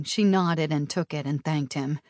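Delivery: ordinary delivery, nothing marked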